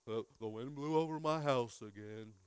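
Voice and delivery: mopey slow Eeyore voice; lightly voiced gloomy Eeyore. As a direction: drag it out like a gloomy donkey. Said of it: "we turn into Eeyore".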